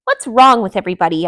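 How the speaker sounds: loud fast exasperation; fed up and baffled; exasperated. A narrator's reading. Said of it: "asked Delilah".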